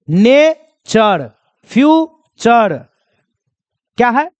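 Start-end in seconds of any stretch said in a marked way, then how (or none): 0.1-2.8 नेचर and फ्यूचर drawn out slowly, ending in chər; slow model words. Shown as a demonstration. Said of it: "तो देखिए".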